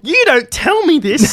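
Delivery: high, drawn-out complaint; mock indignation. Co-host left out of the news, mock indignant. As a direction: play up being wronged. Co-host reacts to unshared outing.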